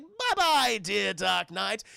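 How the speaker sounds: mock-sweet villain goodbye; Joker. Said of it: "And he's like".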